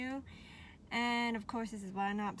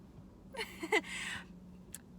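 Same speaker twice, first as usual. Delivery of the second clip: soft breathy laugh; 8 semitones higher, 5 dB quieter than usual. Real, not acted. Laughing at her own story.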